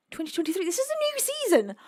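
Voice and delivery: real excited surprise, pitch leaping high; amazed discovery. Real, not acted. Streamer discovers a new season of a favourite show, amazed. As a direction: squeal it in disbelief at the news.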